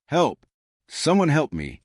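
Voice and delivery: understated storybook cry for help; mild narrated shout. A narrator's reading. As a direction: call for help with restrained urgency. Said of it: "she shouted".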